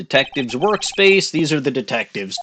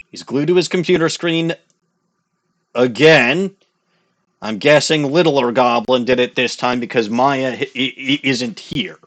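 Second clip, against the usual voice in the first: ordinary talk, nothing marked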